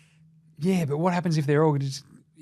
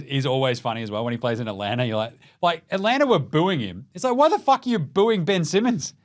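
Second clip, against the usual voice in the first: normal talking voice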